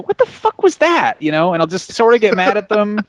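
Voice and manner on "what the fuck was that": high, slow, disbelieving "what the fuck"; incredulous outburst. Retold or imitated. Colleague reacting to a bad article, high and outraged. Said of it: "and I'll just go".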